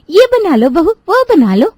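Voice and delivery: high, loud mocking imitation of a bossy elder; mocking mother-in-law. Daughter-in-law grumbling alone in kitchen, mimicking mother-in-law's nagging.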